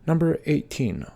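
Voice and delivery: normal talking voice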